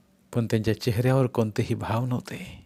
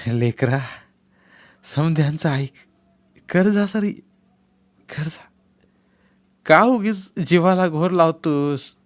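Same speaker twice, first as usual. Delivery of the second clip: a hint of an elder coaxing a son; elder pleading with Bapu; folded-hands plea; 4 semitones higher, 4 dB louder than usual. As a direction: beg him gently but urgently. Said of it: "हात जोडून म्हणाले".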